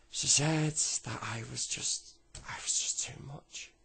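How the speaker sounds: high, loud, drawn-out upset; acted upset. Boy tells granddad what mum said, acted upset. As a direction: force it out slowly, fading at the end.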